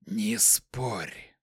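quiet, emotionless hissing voice. A narrator's reading. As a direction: hiss it softly, without feeling. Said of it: "Шипящий голос не выражал никаких эмоций".

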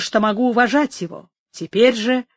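plain reading voice

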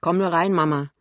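loud, proud invitation. A narrator's reading. Son inviting his mother into the bedroom, loud and proud. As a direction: call it out with pride. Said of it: "sagt Pinneberg voll Stolz".

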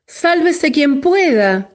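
high, frantic shout; panicked shout. A narrator's reading. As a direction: cry out while fleeing. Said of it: "gritaban todos".

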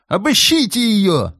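high loud furious yell. A narrator's reading. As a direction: bellow the order. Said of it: "орал Маврушкин". (орёт в ярости)

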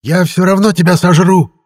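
high, loud creature threat; frenzied high-pitched villain yell. A narrator's reading. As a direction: scream the threat at full voice. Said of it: "вопила сущность".

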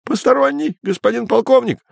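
high desperate blurting. A narrator's reading. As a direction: blurt it out in despair. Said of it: "с отчаянием в голосе выпалил Терман".

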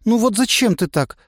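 put-on high, hurt girl's question; girl's reproach. A narrator's reading. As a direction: ask reproachfully. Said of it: "спросила Настя". (обиженный вопрос)

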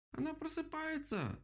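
very high, slow squeak; mouse exclaiming. A narrator's reading. Mouse warns that the owl wakes, squeaking. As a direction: squeal it in alarm, stretched out. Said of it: "воскликнула мышка".

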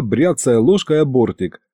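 ordinary delivery

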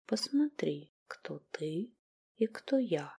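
hushed, touch of contempt; slight contempt. A narrator's reading. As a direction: whisper with faint disdain. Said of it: "лёгкая тень презрения". (лёгкое презрение)

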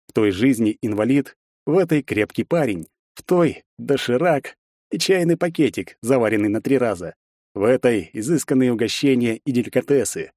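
plain reading voice

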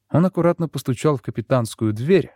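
usual speaking voice

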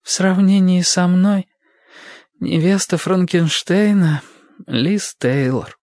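slow, muffled, high woman's voice. Acted. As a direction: drag each word out, dull and muffled. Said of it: "Глуховатым, замедленным голосом".

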